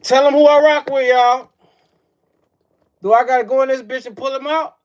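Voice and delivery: high, loud hype yell; full shout. Real, not acted. Streamer yelling at chat over his team. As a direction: scream it at the chat.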